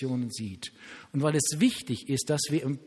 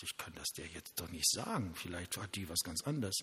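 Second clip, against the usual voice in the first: hushed inner thought; 7 semitones lower, 10 dB quieter than usual. Retold or imitated. Inner doubt quoted as thought.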